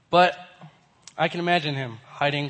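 ordinary talk, nothing marked

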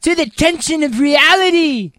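high-pitched surfer singer voice; grand surfer-frontman announcement. Acted. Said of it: "That's the way [name] talks".

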